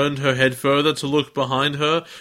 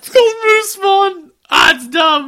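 high, loud, laughing squeal; excited squeal; 6 dB louder than usual. Real, not acted. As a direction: squeal it out in giggling disbelief. Laughing at a silly name.

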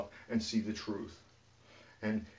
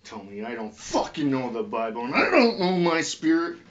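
angry rant; 6 semitones higher, 5 dB louder than usual. Real, not acted. Defiant rant at mockers.

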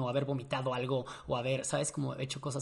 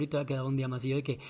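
usual speaking voice

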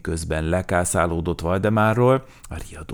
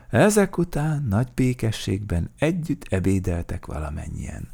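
ordinary delivery

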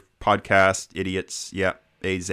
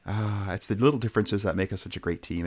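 normal talking voice

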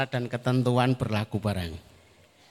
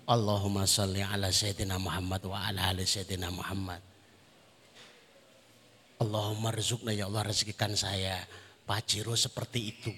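low, soft recited supplication; hushed, earnest-sounding plea to God; mock prayer; 5 semitones lower, 4 dB quieter than usual. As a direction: pray it quietly under your voice. Acts out a prayer for car.

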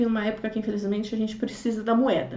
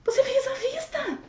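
incredulous question; 13 semitones higher, 4 dB quieter than usual. Retold or imitated. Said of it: "eu fiz assim".

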